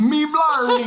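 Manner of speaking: high, loud Irish vowels, stretched; hammy Irish exclamation; Irish accent. Shown as a demonstration. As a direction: cry it out in mock Irish. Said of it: "you just extend them just beyond".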